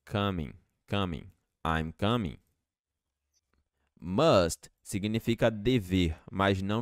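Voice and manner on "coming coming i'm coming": Coming with the O said like kamin; O as A sound. Shown as a demonstration. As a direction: open the O toward A. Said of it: "Esse O vai ficar com som de A".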